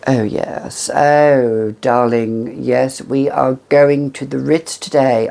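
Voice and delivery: put-on drawn-out aristocratic "oh darling" accent; posh aristocratic accent. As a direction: put on a grand upper-crust accent, stretching the vowels. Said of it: "an accent like that".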